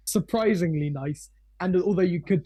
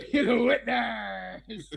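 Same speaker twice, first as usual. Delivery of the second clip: laughing; 4 semitones higher, much slower than usual. Real, not acted. Repeating his shout through laughter.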